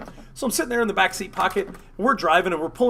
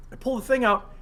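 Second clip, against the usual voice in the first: normal talking voice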